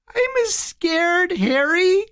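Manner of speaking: put-on very high, small child's voice; thin, high, fearful voice; tinny, scared. A narrator's reading. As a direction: plead like a frightened little boy. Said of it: "his tinny voice uttered".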